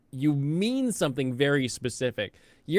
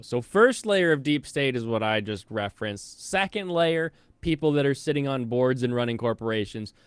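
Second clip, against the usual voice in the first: normal talking voice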